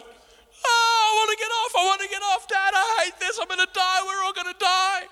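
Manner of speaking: high, loud panicked wail; imitating a panicking child screaming on a roller coaster; terrified crying daughter. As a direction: cry out like a scared little girl on a ride. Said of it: "the whole time she's screaming, she's crying".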